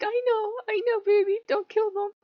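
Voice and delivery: high, soothing, coaxing voice; calm her down like a lover; soothing Loki. Retold or imitated. Loki soothing his angry devotee, tender. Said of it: "And he's like".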